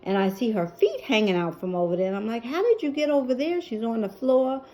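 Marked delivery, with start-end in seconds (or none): 2.5-3.6 high, quick startled question; shocked. Retold or imitated. Daughter finding mom fallen behind the chair, startled. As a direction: blurt it out at the sight.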